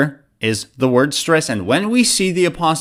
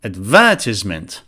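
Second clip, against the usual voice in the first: advertisement stressed on "ver", low and falling; stress on second syllable; 8 semitones lower than usual. Shown as a demonstration. Teacher showing the UK pronunciation, stress on "ver". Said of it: "It's before the second syllable".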